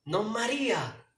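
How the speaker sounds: slow tender call as Jesus; Jesus calling Mary. Acted. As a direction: call her gently by name. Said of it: "El Señor te dice".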